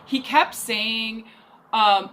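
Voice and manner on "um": usual speaking voice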